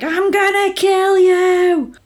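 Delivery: high, slow, drawn-out mock threat; mock fury. Co-host voices the furious heroine threatening, hammed-up fury. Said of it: "she's furious and she's like".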